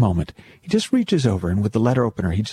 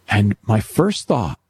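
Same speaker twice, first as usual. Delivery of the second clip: ordinary talk, nothing marked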